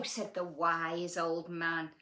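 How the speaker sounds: usual speaking voice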